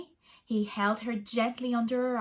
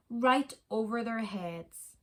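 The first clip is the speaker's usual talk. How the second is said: usual speaking voice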